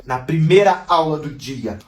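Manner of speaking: ordinary delivery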